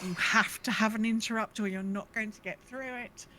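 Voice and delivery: ordinary talk, nothing marked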